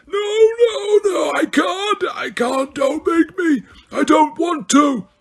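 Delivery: put-on high, desperate screams of an old man in torment; terrified screaming. A narrator's reading. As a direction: shriek it out, begging in terror. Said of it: "Dumbledore screamed".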